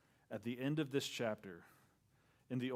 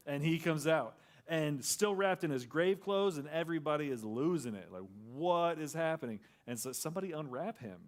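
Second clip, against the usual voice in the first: loud, higher, driving sermon voice; full-force preaching; 4 semitones higher, 6 dB louder than usual. Acted. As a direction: preach it out to the whole room.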